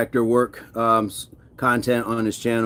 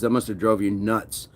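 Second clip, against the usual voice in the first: usual speaking voice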